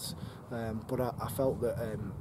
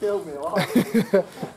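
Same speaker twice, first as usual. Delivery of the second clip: loud, amused burst of laughing; real laughter; 8 semitones higher, 10 dB louder than usual. Real, not acted. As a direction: keep laughing at the tease. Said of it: "sorry I'm laughing".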